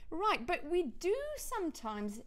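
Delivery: normal talking voice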